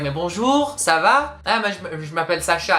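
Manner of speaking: put-on high, airy French girl voice; French accent. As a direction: switch into posh-sounding French. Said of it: "all of a sudden she's like".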